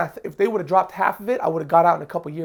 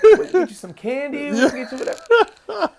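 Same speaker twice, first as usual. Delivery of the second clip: higher, coaxing fake-friendly cop, laughing through it; butter the kid up like a smiling cop; mock friendly cop voice, higher and laughing; sweet-talking good cop; 8 semitones higher, 6 dB louder than usual. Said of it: "Good cop comes in".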